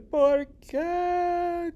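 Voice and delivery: high, loud put-on voice asking why; squeaky character voice, loud and high; man asking why. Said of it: "Aquí el hombre dice".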